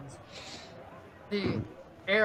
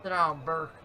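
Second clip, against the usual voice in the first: curt barfly; 5 semitones lower, 5 dB quieter than usual. Acted. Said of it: "he goes".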